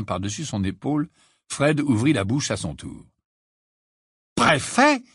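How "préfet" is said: high, rising incredulous question. A narrator's reading. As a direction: ask it in disbelief, pitch jumping up. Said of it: "d'un air incrédule".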